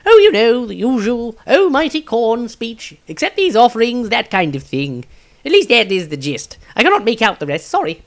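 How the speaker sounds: high, loud offhand wizard voice; high, loud breezy wizard; Maestro's voice. A narrator's reading. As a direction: shrug it off like it's nothing.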